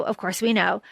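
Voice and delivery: normal talking voice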